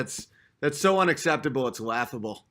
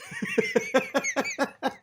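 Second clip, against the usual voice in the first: real laugh; 9 semitones higher, 4 dB quieter than usual. Real, not acted. Laughs at own story.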